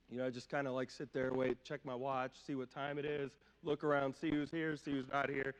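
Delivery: normal talking voice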